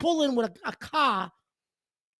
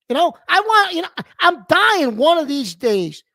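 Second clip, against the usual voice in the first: louder, higher revenge fantasy shouted out; furious venting; 5 semitones higher, 6 dB louder than usual. Real, not acted. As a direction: blow up at the thought of revenge. Fantasizing revenge on bad parkers.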